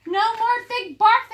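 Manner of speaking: high, loud cheering shout; crowd shout. Acted. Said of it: "the crowd shouted".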